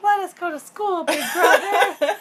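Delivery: high whiny plea; mock pleading kids. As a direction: beg like a kid in a high voice. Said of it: "And the kids are like".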